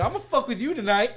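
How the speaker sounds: high, loud playful vow; mock gleeful threat. Retold vow to grandma.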